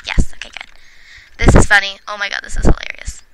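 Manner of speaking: ordinary delivery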